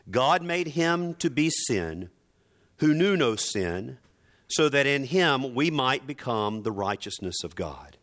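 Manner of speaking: ordinary talk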